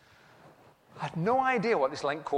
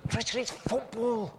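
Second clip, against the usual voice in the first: higher, pleading complaint to his wife; husband pleading with wife over football night; whiny protest; 7 semitones higher, 6 dB quieter than usual. Retold or imitated. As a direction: whine about missing the football. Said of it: "I said".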